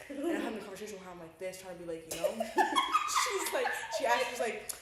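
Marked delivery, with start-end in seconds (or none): 2.3-3.2 sudden squeal of laughter; high laugh burst. Real, not acted. Laughing while telling her friend about her mom. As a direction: laugh out loud at the memory.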